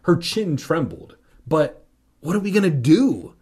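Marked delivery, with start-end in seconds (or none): 1.5-3.3 urgent, breathless and a bit louder; breathless cry. A narrator's reading. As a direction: blurt it out short of breath. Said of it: "she cried breathlessly".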